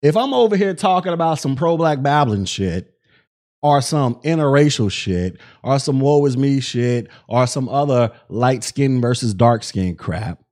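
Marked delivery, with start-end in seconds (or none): none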